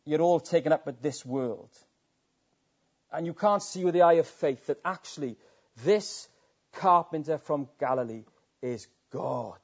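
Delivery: ordinary talk, nothing marked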